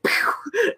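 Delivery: high mock pew launch sound; high pew of a dog launching; zoom sound. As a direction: fire off like a rocket. Dog shooting off.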